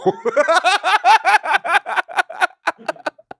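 loud high laughter; big laugh. Real, not acted. Comedian cracking up at the girl's letdown, big laugh.

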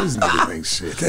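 breathy laugh-whisper. Retold or imitated. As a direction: laugh it out under your breath. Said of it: "I said".

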